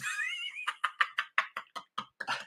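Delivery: high, breathy wheezing laugh; real laughing fit. Real, not acted. Host cracking up over the crotch story, wheezing laugh. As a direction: laugh helplessly until it squeaks.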